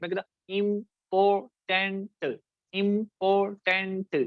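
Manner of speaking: इंपॉर्टेंट drawn out slowly, syllable by syllable; slow syllable demo. Shown as a demonstration. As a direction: spell out इंपॉर्टेंट slowly in beats. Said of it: "इसको हम कहते हैं syllables".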